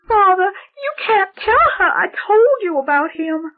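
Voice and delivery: panicking so he won't tell; hysterical little girl. Acted. Girl pleads with the priest, very high and hysterical. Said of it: "She was becoming hysterical".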